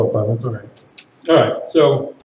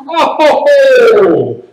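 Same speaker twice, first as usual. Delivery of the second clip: loud, raised, chuckling exclamation; hammed-up laughing cry; 6 semitones higher, 5 dB louder than usual. Shown as a demonstration. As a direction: roll a laugh through a long oh. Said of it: "get that laugh on the oh".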